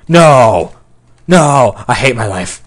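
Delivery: loud cries of frustration; despairing yell. Real, not acted. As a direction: shout it in exasperation at losing. Dies in game, yelling.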